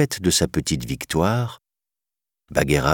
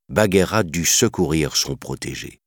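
ordinary delivery, nothing marked

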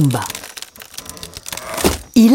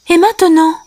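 high, louder character question; Simba; 10 semitones higher, 5 dB louder than usual. A narrator's reading. Simba asks on arriving at the pond, high voice. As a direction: ask it in a high lion-hero voice. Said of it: "demanda Simba".

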